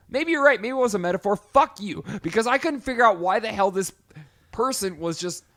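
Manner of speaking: loud, high-pitched outburst; exasperated rant. Real, not acted. Host conceding the metaphor point then cursing, loud exasperation. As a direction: snap back at your co-host, high and loud.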